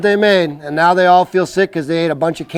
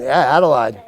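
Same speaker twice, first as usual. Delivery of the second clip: ordinary delivery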